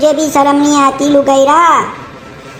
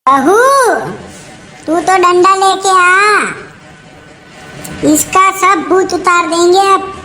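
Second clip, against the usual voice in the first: higher, commanding mother-in-law's voice; 4 semitones higher than usual. Acted. Mother-in-law telling daughter-in-law to fetch the stick, higher voice. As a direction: order the beating with relish.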